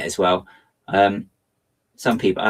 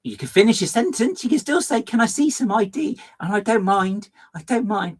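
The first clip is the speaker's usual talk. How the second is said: high, sing-song mock courtesy; mock polite reply; 12 semitones higher, 3 dB louder than usual. Streamer retelling what they wanted to tell the shop assistant, high mock politeness. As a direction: act sweetly put-upon. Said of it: "It's like".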